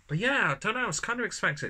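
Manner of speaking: high, loud exclamation of doubt; a tasting that disappoints; surprised. Real, not acted. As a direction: pitch jumps up in surprise. Surprised doubt about sweetness.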